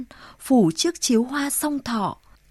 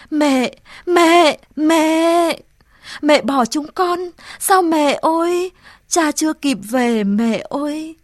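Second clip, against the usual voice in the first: high, loud sobbing cries to mother; weeping call; 4 semitones higher, 4 dB louder, slower than usual. Acted. Boy kneeling by his dead mother, weeping calls. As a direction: plead with her through tears, fading at the end. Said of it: "phủ phục xuống bên mẹ gọi".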